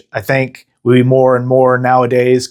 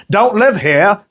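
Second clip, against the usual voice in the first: a high, bad Australian accent, more gangster than Aussie; attempted Australian accent; 6 semitones higher than usual. Host impersonating hostile locals in a botched Australian accent. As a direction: taunt like a mocking Aussie local with a failed accent. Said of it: "that sounded more like nineteen twenties gangster".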